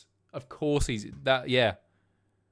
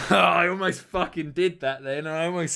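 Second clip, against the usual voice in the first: loud, higher laughing disbelief; 5 semitones higher, 7 dB louder than usual. Real, not acted. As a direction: burst out laughing at your own near slip. Laughs at narrowly avoided slip.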